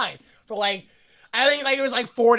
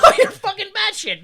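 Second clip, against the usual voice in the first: laughing outburst; 10 semitones higher, 6 dB louder than usual. Real, not acted. Laughing outburst at movie character.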